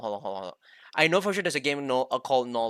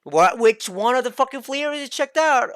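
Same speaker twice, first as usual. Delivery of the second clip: frustrated yelling; 8 semitones higher, 7 dB louder than usual. Real, not acted. Frustrated ranting at menu screen.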